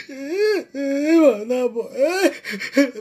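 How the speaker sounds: drawn-out fake sobs between words; mock crying. Crying to madame over mother's photo, mock sobs. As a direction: sob out each little cry slowly. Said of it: "comme ça, je pleure".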